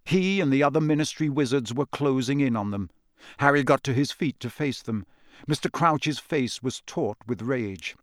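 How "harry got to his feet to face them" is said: ordinary delivery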